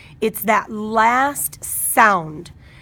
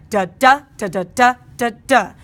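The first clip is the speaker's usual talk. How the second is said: "da" syllables alternating light and heavy, the heavy ones punched louder; heavy and light beats; 5 dB louder than usual. Shown as a demonstration. Teacher showing students heavy and light beats, rhythmic stressed "da da". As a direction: punch every heavy "da", keep the others light. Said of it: "some of those beats are heavy".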